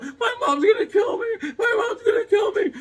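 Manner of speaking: put-on very high wailing six-year-old; hammed-up panicky child's sob; mock crying. Six-year-old fearing his mom after the river, mock wail. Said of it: "I'm crying. I was, like, six years old".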